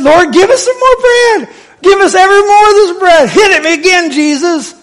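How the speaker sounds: very high, excited mock pleading; clamoring crowd. Acting crowd clamoring for more bread.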